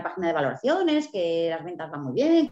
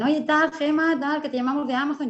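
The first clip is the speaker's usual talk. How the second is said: imitating the Amazon caller; 6 semitones higher than usual. Writer retells the call from Amazon, in a cheerful caller voice. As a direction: lightly play the upbeat caller.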